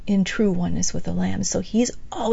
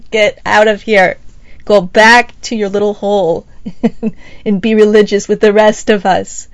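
loud, scornful order to go home; scolding passion police; 8 dB louder than usual. Retold or imitated. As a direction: shoo the troublemaker off, louder. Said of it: "they're like".

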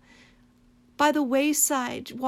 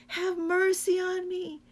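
higher, pleading cry; crying for mercy; 7 semitones higher than usual. Retold or imitated. Blind man begging Jesus for mercy, pitched high. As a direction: beg him across the crowd. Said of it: "crying out when he hears".

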